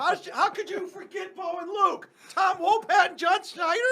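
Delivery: high, loud, laughing shout; hammed-up disbelief, yelling; mock outrage. Mock outrage at friend forgetting.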